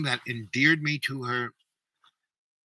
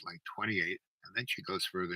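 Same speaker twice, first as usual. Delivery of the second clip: ordinary talk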